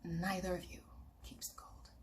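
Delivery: soft secret whisper; whispered verdict from the elder. Acted. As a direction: breathe it like a secret. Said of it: "I'll say it again".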